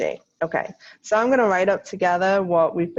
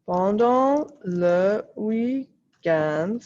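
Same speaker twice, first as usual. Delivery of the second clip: pendant le week-end drawn out slowly while writing; slow dictation; much slower than usual. Acted. Teacher dictating slowly to her students. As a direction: spell it out slowly for the class to copy.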